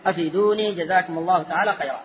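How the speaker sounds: ordinary talk, nothing marked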